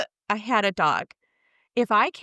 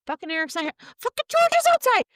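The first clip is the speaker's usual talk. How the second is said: much higher, louder, fast outburst; hammed-up alarmed shriek about the dog; mock outrage; 5 dB louder, much faster than usual. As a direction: explode at finding the dog out. Imagined discovery at home.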